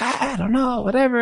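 high, evasive shrug of a reply; evasive mumble. Retold or imitated. Husband dodging questions about work, high and evasive. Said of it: "he was always like".

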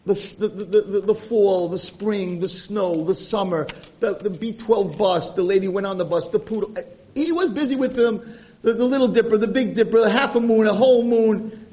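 high-pitched, building enthusiastic rundown; swept-up retelling of a nonstop description; excited listing. Retold or imitated. Breathless list of everything he described.

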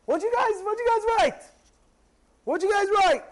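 high-pitched urgent demand, repeated; urgent questioning. Retold or imitated. King's aide to the letter writers, urgently questioning.

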